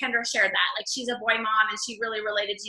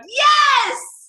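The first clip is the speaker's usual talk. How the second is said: much higher, loud cheering yes; crowd yelling yes; 13 semitones higher, 10 dB louder than usual. Retold or imitated. Women answering can you relate, loud cheering yes. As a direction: shout it like a whole crowd agreeing. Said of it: "everybody was like".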